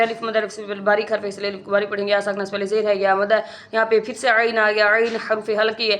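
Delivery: ordinary delivery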